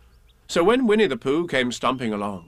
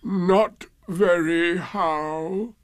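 very slow, higher mournful drone; Eeyore, gloomy; 6 semitones higher, much slower than usual. A narrator's reading. Gloomy donkey answering Pooh's greeting, very slow. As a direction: drag it out like a dejected donkey. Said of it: "Eeyore shook his head from side to side".

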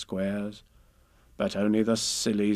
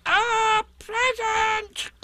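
very high, drawn-out squeal; Piglet very loudly; 3 dB louder, much slower than usual. A narrator's reading. Piglet repeats himself for Eeyore, very high slow squeal. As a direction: stretch each word in a piercing squeak. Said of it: "said Piglet very loudly".

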